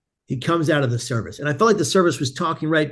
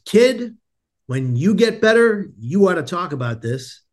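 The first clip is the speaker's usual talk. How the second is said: urging advice; 3 semitones higher, slower than usual. Retold or imitated. Chaplain to patient after praying together, earnestly urging. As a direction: call him to attention, then advise slowly.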